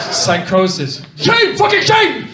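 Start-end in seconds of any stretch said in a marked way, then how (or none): 1.1-2.3 angry shout. Retold or imitated. Heckler keeps shouting.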